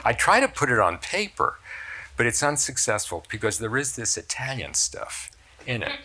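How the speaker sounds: ordinary talk, nothing marked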